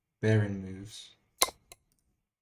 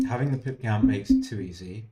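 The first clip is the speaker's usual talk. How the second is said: ordinary talk, nothing marked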